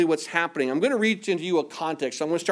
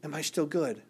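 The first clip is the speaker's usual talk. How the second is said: hushed, much softer question; whispered; 2 semitones lower, 7 dB quieter than usual. Retold or imitated. The Spirit speaking softly to the preacher, hushed. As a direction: ask it gently like a still small voice. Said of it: "God in his spirit kind of whispered to me".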